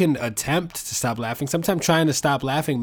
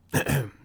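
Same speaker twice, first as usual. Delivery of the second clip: throat clear. Real, not acted. Clears throat before retake.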